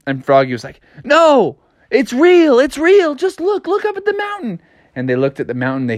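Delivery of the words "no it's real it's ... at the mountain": high, urgent cartoon voice; pleading frog character. A narrator's reading. Froggy to townsfolk who doubt him, high and urgent. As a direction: insist in a high, frantic pitch.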